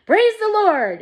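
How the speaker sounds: high, loud cry of praise; jubilant. A narrator's reading. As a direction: shout thanks to heaven. Grandpa Joe celebrating.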